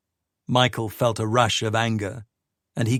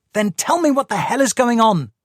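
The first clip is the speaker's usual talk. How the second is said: higher, louder exasperated outburst; angry demand; 12 semitones higher, 6 dB louder than usual. Acted. Sadie confronting Michael, loud exasperated demand. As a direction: demand an answer, voice rising.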